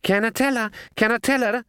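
high eager squawk. Acted. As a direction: squawk it like an excited crow. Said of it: "it squawked".

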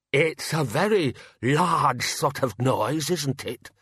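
slow, high, wavering-pitch character voice; uneasy bursar. Acted. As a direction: venture it slowly and uneasily. Nervous bursar hears the creature.

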